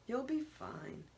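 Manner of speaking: slow, gentle reassurance; calm her down, slowly; soothing. Acted. Husband soothing his frightened wife, slow and gentle. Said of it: "He soothingly strokes my hair".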